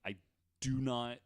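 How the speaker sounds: ordinary delivery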